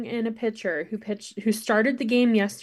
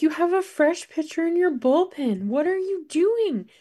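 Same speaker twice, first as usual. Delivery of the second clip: high-pitched mock yell at the TV; retold exasperated yelling; 9 semitones higher than usual. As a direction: plead with the coach through the screen, pitch way up. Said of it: "yelling at the T V".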